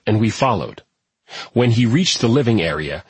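plain reading voice

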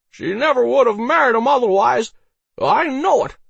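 put-on high, loud drunken voice; drunken tale-teller. A narrator's reading. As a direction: ramble loudly like an insistent drunk. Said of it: "Harold nodded drunkenly".

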